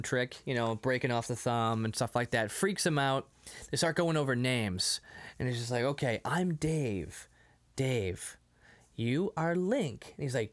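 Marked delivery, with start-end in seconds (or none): 5.8-10.1 slow, spaced-out introduction; slow name teaching. Retold or imitated. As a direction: spell it out for a learner, word by word. Teaching names to a caveman.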